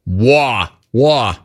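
lightly imitating a New Yorker's "war"; mock New York accent. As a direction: echo the caller's New York vowel. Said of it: "Bro, are you from New York?".

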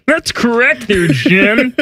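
lightly voiced Kermit the Frog; a hint of the Kermit impression. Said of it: "So here's my Kermit".

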